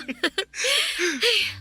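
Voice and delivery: high, gleeful laugh; laugh, proud of the compliment; pleased laugh. Acted. Proud of her empanada. (masayang tawa sa papuri)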